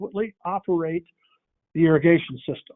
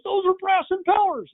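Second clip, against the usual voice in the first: much higher, thrilled contractor; excited exclamation; 12 semitones higher than usual. Retold or imitated. Contractor marvels at brass impellers at the show, excited high exclamation. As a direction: blurt it out like he just found treasure.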